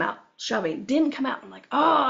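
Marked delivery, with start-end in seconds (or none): 1.7-2.0 frustrated groaned "oh", a bit louder and higher; exasperated outburst, acted out. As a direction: retell a burst of frustration. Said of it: "I got frustrated".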